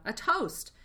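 loud, high call for a toast. A narrator's reading. Badger calls the feast to a toast, loud. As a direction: raise the glass and call it out. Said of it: "called out".

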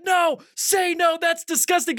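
high-pitched outraged coworker; appalled urging. Retold or imitated.

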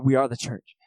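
quick dismissive put-down of the friend; mild mock snap. Buddy snapping at friends, lightly mocked. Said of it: "he would always snap on you".

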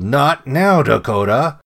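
loud, exasperated yell at a dog. A narrator's reading. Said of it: "I yelled".

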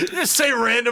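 cracking up mid-sentence; words squeezed out through big laughs; laughing hard. Real, not acted. Laughing at Kyle's voice.

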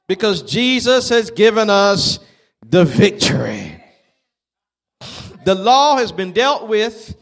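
loud, drawn-out sermon delivery; loud preacher's proclamation; forceful preaching. Acted. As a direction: proclaim victory to the congregation, leaning on key words. Preaches to congregation.

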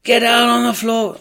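high, loud barked order; a hammed-up command; mock police shout. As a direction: shout like an armed officer storming in. Armed police bursting in.

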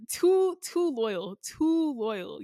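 teasing a man for being too loyal on a dating show; mock exasperation. Real, not acted. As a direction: drag out the "too" in disbelief. Mocking a man's loyalty.

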